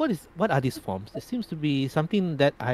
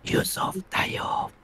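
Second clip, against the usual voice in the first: slow, breathy sultry woman; sultry lady's voice; much slower than usual. Acted. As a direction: purr it like a seductive lady. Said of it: "in a sultry lady's voice".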